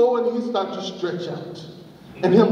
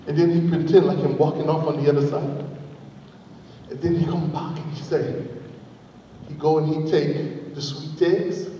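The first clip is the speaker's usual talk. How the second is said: usual speaking voice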